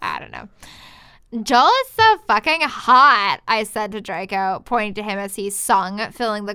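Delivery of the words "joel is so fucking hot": gushing. Acted. Reading fangirl line.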